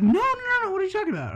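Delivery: high-pitched, loud panicked protest; retold high, alarmed backpedalling; flustered denial. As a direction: squeal out a mock-frantic denial. Retold flustered denial of being bisexual.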